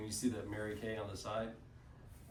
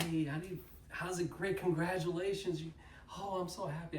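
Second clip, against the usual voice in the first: higher-pitched gushing greeting; mock-gushy high voice; excited congratulations; 5 semitones higher than usual. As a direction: greet a friend with delighted congratulations. Congratulating director on earning car.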